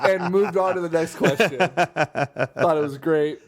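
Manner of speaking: talking through real laughter; amused, laughing and slowing down. Real, not acted. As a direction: laugh while you say it.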